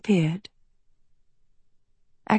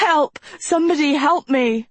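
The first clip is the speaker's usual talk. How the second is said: higher-pitched desperate plea; cries for help; 6 semitones higher than usual. A narrator's reading. Trapped Agatha calling for somebody to save her, desperate cry. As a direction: cry out for rescue. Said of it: "suffocating her cries".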